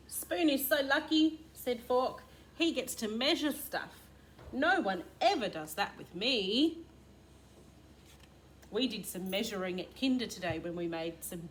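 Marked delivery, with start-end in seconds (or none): 0.1-6.8 lightly voiced Fork.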